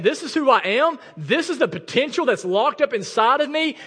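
loud, high-pitched, driving sermon delivery; full-force preaching. Real, not acted. Pastor challenging people who shrug off sin, full-force preaching.

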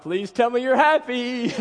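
imitated high, emotional pleading; mock a wife's high-pitched, teary plea; tearful plea. Husband retelling wife's pregnancy reveal, high pleading imitation. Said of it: "tears are welling in her eyes".

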